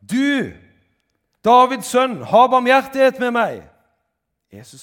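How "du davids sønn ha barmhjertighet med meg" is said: full-force, high-pitched desperate shout; very loud, high, insistent cry; shouting at the top of the voice. A narrator's reading. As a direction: yell it out for mercy with everything you have. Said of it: "han ropte bare enda høyere".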